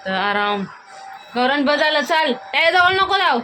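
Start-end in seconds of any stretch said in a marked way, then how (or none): 1.4-3.4 high, loud urging; calling out. Real, not acted. Mother calling the kids away to bed, high and loud. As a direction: get them moving, firmly. Shooing child off.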